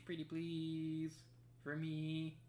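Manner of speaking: high, slow pleading voice; sweetly beg, drawn out; cutesy begging. Pretend. Begging the puzzle to work.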